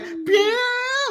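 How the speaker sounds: a sudden high zap, pew; high pew sound effect. Retold or imitated. Mocking a cheesy fairy dust graphic with a high zap. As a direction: squeak out a cartoon sparkle noise.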